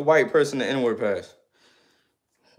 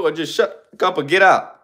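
ordinary delivery

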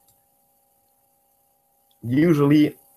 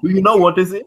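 higher-pitched mock quizzing; retold teasing question; 8 semitones higher, 4 dB louder, much faster than usual. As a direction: quote the joker, pitch lifted through the question. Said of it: "They asked me".

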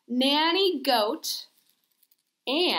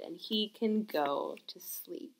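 whispering; 5 semitones lower, 10 dB quieter than usual. Real, not acted. Bear put to sleep.

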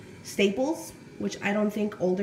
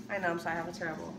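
ordinary delivery

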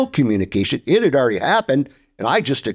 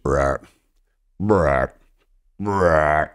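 grunting buck call; 6 semitones lower, much slower than usual. Retold or imitated. Imitating a rutting buck.

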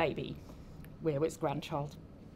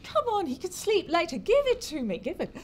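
high, insistent coaxing; wheedling; 10 semitones higher than usual. Acted. Grandmother pesters daughter for the sleeping baby, wheedling. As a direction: pester for it in a raised voice.

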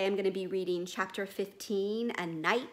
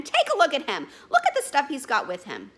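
loud, higher, outraged; indignant boatman; 7 semitones higher, 11 dB louder than usual. Acted. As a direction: make him look, indignant.

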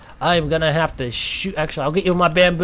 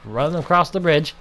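ordinary talk

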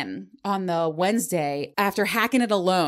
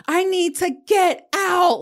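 much higher, louder, slowed, frantic begging; high slow panicked cry to get out; desperate plea; 11 semitones higher, 6 dB louder, slower than usual. Retold or imitated. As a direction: plead as if trapped and panicking. Frantic text to Jill.

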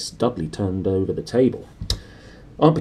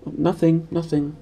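put-on evasive boy; 8 semitones higher than usual. Harry dodges aunt.

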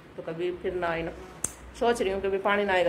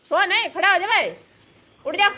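calling to wake him; 11 semitones higher, 5 dB louder than usual. Real, not acted. Waking sleeping kid in the morning.

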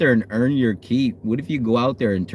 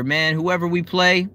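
normal talking voice

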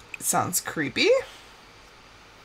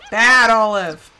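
loud, slow telling-off; scolding a pet; 3 semitones lower, 8 dB louder, slower than usual. Pretend. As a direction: scold the pet firmly, drawn out.

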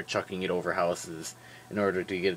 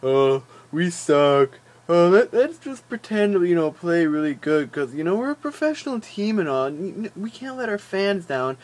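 loud, high, lazy mock-whine of a team that doesn't care; mock whiny pro athletes slacking off; 7 semitones higher, 5 dB louder than usual. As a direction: ham up a half-hearted team shrugging it off. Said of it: "They just were like".

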